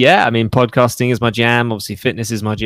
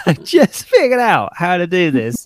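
higher, half-laughing gripe at another app update; exasperated mock rant; 7 semitones higher than usual. Mock groan about an app update, pitched up with a laugh. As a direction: play the user fed up that every change undoes what they just learned. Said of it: "And you're like".

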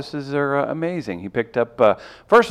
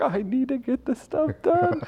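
high stressed whine; 13 semitones higher, 7 dB quieter, slower than usual. Retold or imitated. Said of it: "I'm just like".

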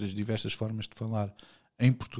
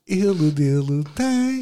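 lightly voiced supermarket slogan, louder and higher; mock jingle; 6 semitones higher, 8 dB louder, slower than usual. Joking that the pronoun sounds like a supermarket name, mock slogan. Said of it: "excelente nome para supermercado".

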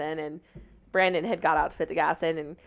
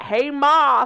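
higher, louder mock call; imitated calling out; 5 semitones higher, 7 dB louder than usual. Imitates his call to mother.

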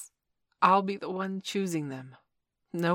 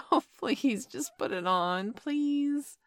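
ordinary delivery

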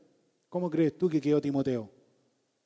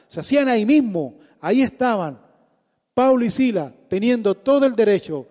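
impassioned loud sermon delivery; preaching at full force; 3 semitones higher, 5 dB louder than usual. Acted. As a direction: preach it hard and fervent. (predicación apasionada a toda voz)

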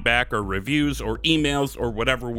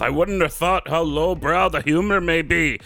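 hammy heroic dwarf reading a fan letter; Magni Bronzebeard; 5 semitones higher than usual. Acted. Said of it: "Magni says".

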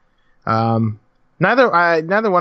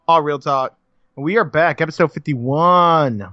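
normal talking voice

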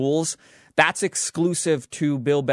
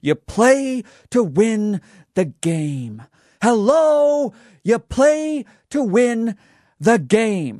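slow, fired-up coach rant, Hello yelled much higher and louder; fired-up Herm Edwards; 7 semitones higher, 3 dB louder, much slower than usual. Retold or imitated. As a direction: hammer each word like a coach blowing up at the podium. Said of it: "he was all fired up, and he goes".

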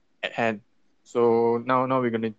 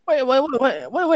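high-pitched envious whine; whiny high complaint; 13 semitones higher than usual. Real, not acted. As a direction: whine it like it's unfair.

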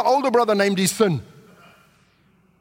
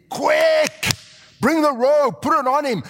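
excited shouted orders; urgent command; 6 semitones higher, slower than usual. Retold or imitated. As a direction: hurry them along with excitement. Father shouting orders to servants.

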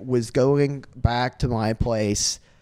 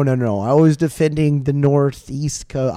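ordinary talk

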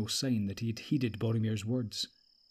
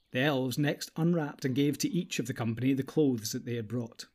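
ordinary delivery, nothing marked

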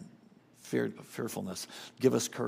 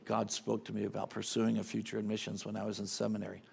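much softer and lower, a hint of regret; quiet confession; 4 semitones lower, 7 dB quieter than usual. Francis Chan confessing to young people, softly. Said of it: "He confessed openly".

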